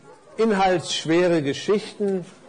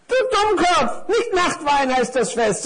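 high, cross necktie voice; exasperated scolding; 11 semitones higher, 4 dB louder than usual. Acted. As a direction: scold the dimwit at high pitch. (hohes, empörtes Schimpfen)

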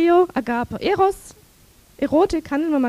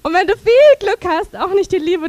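loud; 4 semitones higher, 7 dB louder than usual. Real, not acted. Punchline after laughter.

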